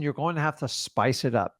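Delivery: ordinary delivery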